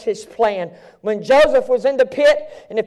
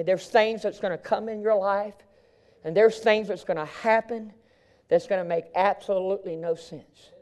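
ordinary talk, nothing marked

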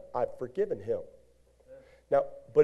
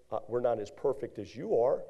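usual speaking voice

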